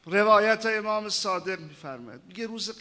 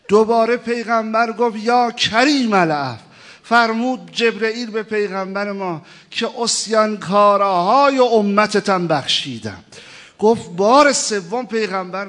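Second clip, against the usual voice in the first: loud, high rising sermon climax; preaching at full force; 5 semitones higher, 12 dB louder than usual. Acted. Preacher telling the Prophet's cry, at full force. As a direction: build the story to a ringing peak.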